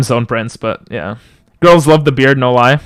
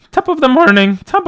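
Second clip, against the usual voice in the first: Jacksepticeye greeting; 11 semitones higher than usual. Retold or imitated. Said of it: "does he say top?".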